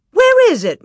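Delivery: high, loud frightened cry. A narrator's reading. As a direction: shriek it in alarm. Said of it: "Ma cried out".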